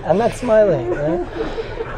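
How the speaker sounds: trying not to laugh while denying it; stifled giggle through the words. Real, not acted. Rabbi holding back laughter before his class. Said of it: "Why are you smiling?".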